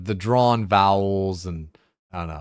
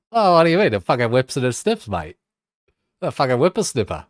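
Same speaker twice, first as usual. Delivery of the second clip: loud, high hammed-up Aussie bloke; mock Aussie; 5 semitones higher, 6 dB louder than usual. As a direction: play the rowdy Aussie mate. Mock Aussie riff on whippersnipper.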